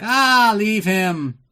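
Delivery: high, loud, lightly put-on villain brushing it off; dismissive villain. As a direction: wave him off like a bored villain. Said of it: "Kristatos is like".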